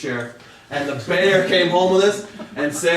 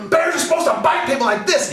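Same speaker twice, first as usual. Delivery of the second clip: much higher mock yell; furious shout; 10 semitones higher than usual. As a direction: shriek it in outrage. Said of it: "He's like".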